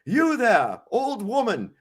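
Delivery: imitating King Arthur hailing a peasant; haughty king. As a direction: call out like a pompous king. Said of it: "he says".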